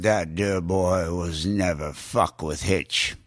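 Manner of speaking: low, slow, menacing Hitchcock. Retold or imitated. Hitchcock voiced in retold story.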